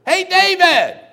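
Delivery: loud high yell across a field; calling out. Retold or imitated. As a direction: shout his name from far off. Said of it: "just then I heard".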